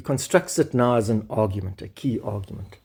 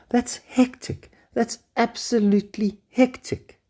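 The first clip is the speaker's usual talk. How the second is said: astonished; 9 semitones higher than usual. Real, not acted. Teacher reacting to the paper's claim, astonished.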